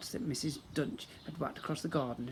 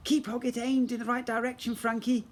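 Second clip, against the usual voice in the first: urgent high instruction; 9 semitones higher, 4 dB louder than usual. Acted. Mum urging Frankie.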